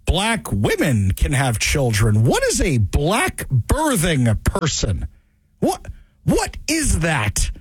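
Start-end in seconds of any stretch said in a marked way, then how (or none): 5.6-7.5 baffled. Real, not acted. Confused by term.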